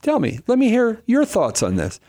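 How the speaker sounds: bright teasing high-pitched Jesus voice; playful high voice. Retold or imitated. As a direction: tease the lawyer, voice up high. Jesus turns question back playfully.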